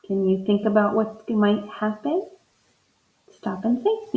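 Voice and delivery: ordinary talk, nothing marked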